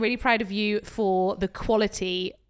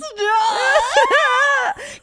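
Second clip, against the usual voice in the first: loud burst of laughter; 12 semitones higher, 8 dB louder than usual. Real, not acted. Laughs at own habit.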